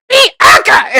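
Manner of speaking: put-on loud high shout of a name in a Turkish accent. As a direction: call the girl's name like a strict teacher with an accent. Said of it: "in her, like, Turkish voice".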